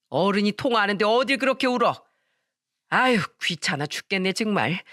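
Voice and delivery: mother-in-law grumbling on the phone about the crying twins, irritated. A narrator's reading. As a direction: grumble at the crying babies like a fed-up grandmother. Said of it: "짜증 섞인 목소리로".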